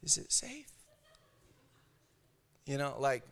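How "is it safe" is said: whispered, peeking out nervously; hushed and fearful from inside the shell; timid turtle whisper. Acted. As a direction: breathe it like a scared turtle.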